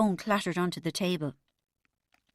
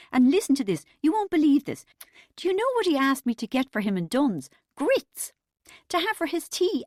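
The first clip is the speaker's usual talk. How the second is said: high, loud, overbright chatter; forced cheerful mother's rant; fake upbeat; 6 semitones higher, 5 dB louder than usual. A narrator's reading. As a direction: gabble brightly to hide nerves. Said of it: "in a shaky, fake upbeat voice".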